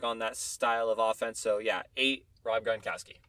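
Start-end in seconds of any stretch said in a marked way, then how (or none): none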